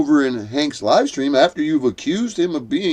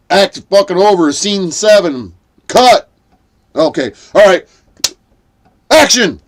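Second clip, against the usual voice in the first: loud, higher barked set calls; mock director calls; 5 semitones higher, 7 dB louder than usual. Mock director shouting set calls to the cast. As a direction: call the cuts like a film director. Playing a film director calling scenes.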